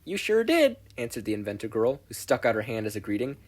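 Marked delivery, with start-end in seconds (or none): 0.0-0.8 high, loud cheerful confirmation. Acted. Mei teasing Izuku, cheerful and high. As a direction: toss it back brightly.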